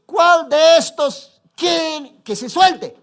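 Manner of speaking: Pilate calling out slowly and loudly; slow loud question. Acted. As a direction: put the choice to the whole crowd. Pilate asks the crowd. (pregunta lenta y gritada)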